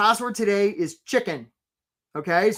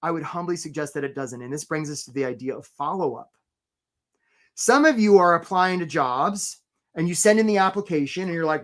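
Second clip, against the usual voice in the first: usual speaking voice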